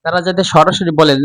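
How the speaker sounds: ordinary delivery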